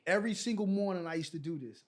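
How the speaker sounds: normal talking voice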